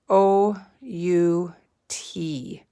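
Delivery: O U T spelled slowly letter by letter; slow letter spelling. Shown as a demonstration. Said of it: "S H".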